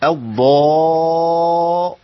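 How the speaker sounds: drawn-out emphatic daad sound; heavy emphatic daad. Shown as a demonstration. Teacher drawing out the emphatic ض for students. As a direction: stretch the heavy ض slowly. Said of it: "ضاد مفخمة".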